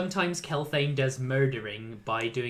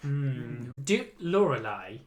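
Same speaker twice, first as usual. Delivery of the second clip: ordinary talk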